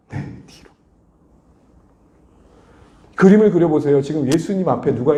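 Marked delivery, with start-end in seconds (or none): 0.1-0.7 breathy whisper of the key words. Shown as a demonstration. Pastor stressing Jesus' key words, whispered. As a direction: hush it like a secret. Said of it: "더 중요한 게 뭐예요?".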